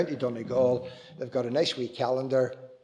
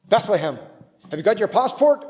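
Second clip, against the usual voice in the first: sentry questioning; 7 semitones higher, 8 dB louder than usual. Retold or imitated. Soldier questions the children.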